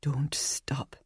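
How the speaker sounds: soft breathy urging; hushed voice urging her on; mysterious whisper. A narrator's reading. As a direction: whisper it, compelling. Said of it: "that mysterious voice whispered".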